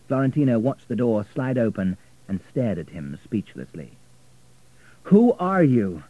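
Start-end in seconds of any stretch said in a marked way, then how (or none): 5.0-6.0 slow, loud, high challenge; demanding. A narrator's reading. Richard confronting Florentina at the lift, demanding. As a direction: demand an answer, hitting it hard. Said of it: "demanded Richard".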